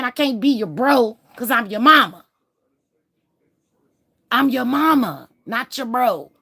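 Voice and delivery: loud, rising anger at her son; scolding mother. Real, not acted. Mother scolding son about "bro.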